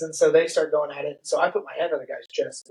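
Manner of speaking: ordinary talk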